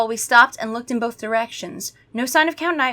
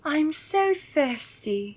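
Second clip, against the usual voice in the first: higher, very slow soft moan; thirsty vampire; 7 semitones higher, 4 dB quieter, much slower than usual. Acted. Gwendolyn craving blood, slow soft moan. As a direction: draw out the hunger softly. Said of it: "Gwendolyn said".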